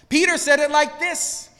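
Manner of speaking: ordinary talk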